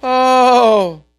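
loud, fretful wailing "oh"s; mock frightened moaning; mock panic. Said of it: "and we say".

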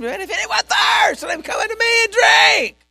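high, loud proclaiming shout; screaming. Acted. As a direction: cry out to the whole feast. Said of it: "stood up in the middle of the feast and screamed".

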